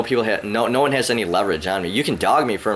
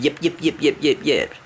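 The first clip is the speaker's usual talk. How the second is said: a high yappy "yip yip" to mock her chatter; mocking yapping; 6 semitones higher than usual. Mocking a talkative ex with high yapping. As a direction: yap it out like a small dog to make fun of her. Mocking someone who talks too much.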